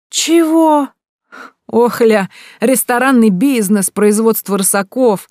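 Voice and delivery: кривляясь, высоко и громко; передразни гостя писклявым кривлянием; передразнивая. A narrator's reading. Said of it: "передразнил брат". (брат передразнивает Богдана, кривляясь высоким голосом)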